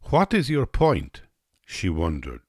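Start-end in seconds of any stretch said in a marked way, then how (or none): 0.0-1.2 higher-pitched, questioning Russian woman; a puzzled hostess's query. A narrator's reading. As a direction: voice her question with a high lift. Russian woman quoted in story.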